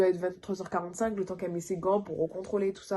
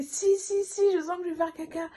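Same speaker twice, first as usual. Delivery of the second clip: high insistent si si; 9 semitones higher than usual. Retold or imitated. Mother to the midwife, high and insistent. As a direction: stubbornly insist.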